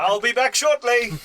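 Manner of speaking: high, hammed-up parting call; acted heroic farewell. Charging rider calling back to comrades, mock-heroic call. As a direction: call back as you ride off.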